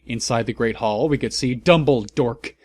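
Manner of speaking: ordinary delivery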